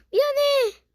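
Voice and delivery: high, loud sorry; loud high apology. Real, not acted. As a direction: cry out an apology. Apologizing to the pig. (크게 외치는 사과)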